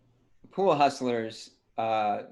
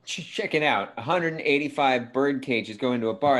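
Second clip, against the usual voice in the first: ordinary talk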